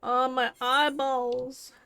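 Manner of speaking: pained groan. Real, not acted. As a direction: moan it like your eyes hurt.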